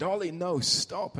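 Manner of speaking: imitating an urgent shout to stop a child; retold shout. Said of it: "I shouted".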